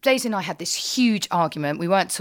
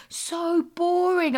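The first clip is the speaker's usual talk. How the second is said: put-on bored kids; 9 semitones higher, much slower than usual. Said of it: "They just keep going".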